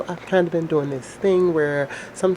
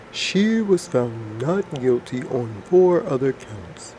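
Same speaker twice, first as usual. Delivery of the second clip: lower, slow, forced-deep reading voice; Elizabeth Holmes deep voice; 3 dB louder, slower than usual. Pretend. Vlogger reads Holmes news story in her forced-deep Holmes voice. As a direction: press the voice down as deep as it goes and read slowly. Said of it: "impersonation of Miss Holmes".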